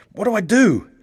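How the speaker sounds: high, pleading panic; desperate for an answer; panicked question. A narrator's reading. Johnny panicking at speed, desperate.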